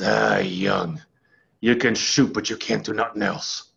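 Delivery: low, gruff old coach impression. Former player quoting legendary coach to a freshman, gruff. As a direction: grunt it out low, barely interested. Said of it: "he's got this gruff voice".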